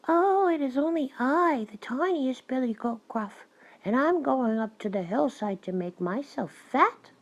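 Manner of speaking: high, tiny billy goat; small goat voice. A narrator's reading. Youngest goat answers the troll, in a small high voice. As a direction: pipe up high and little. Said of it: "with such a small voice".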